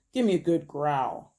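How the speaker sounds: usual speaking voice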